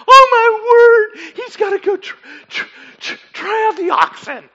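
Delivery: high, loud wail of helpless laughter; hammed-up cracking up, barely getting words out; acted hysterics. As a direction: squeal it, stuttering as you crack up.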